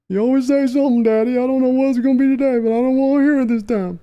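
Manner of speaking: put-on timid boy answering his father; whiny little son. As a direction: beg your daddy not to yell. Son voiced pleading with father.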